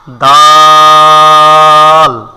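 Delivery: দাল with a very long level vowel, loud; loud, sustained দাল; drawn-out dal. Shown as a demonstration. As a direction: stretch দাল on one steady note. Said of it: "একটু টান দিয়ে পড়তে হবে".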